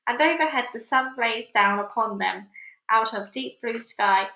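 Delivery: ordinary delivery, nothing marked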